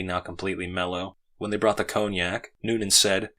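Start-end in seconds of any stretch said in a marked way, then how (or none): none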